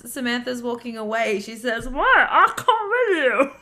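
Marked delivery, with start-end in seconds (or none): 1.9-3.5 much higher, louder, slow squeal; mock Claire Ann. Friend acting out Claire Ann's line for the group, high and slow.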